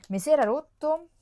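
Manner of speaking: mock-tragic cry about a broken product; dismayed outburst. Vlogger to her viewers, dismayed outburst.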